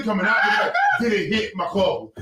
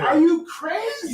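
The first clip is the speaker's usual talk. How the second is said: high, slow, incredulous; mock outraged question; 8 semitones higher, slower than usual. To the altar helper, high slow disbelief. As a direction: stretch it out in disbelief.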